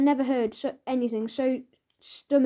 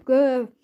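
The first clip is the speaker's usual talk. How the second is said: creature growl; 7 dB louder than usual. Acted.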